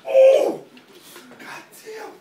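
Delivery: loud, high excited yell; excited shout. Real, not acted. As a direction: yell out in shock and delight. Friends react to a wild stunt.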